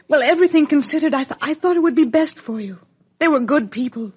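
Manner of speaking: flustered justification. Acted. Mother justifying leaving her baby with the Logans, flustered and rushed. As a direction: hurry through it, flustered and defensive.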